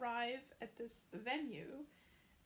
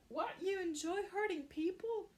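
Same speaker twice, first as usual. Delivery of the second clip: higher, louder incredulous question; shocked disbelief; 6 semitones higher, 4 dB louder than usual. Retold or imitated. Noah appalled at Nick's love of fighting, incredulous. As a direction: recoil in disbelief.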